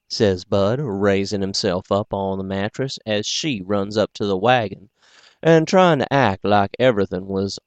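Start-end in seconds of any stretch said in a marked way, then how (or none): none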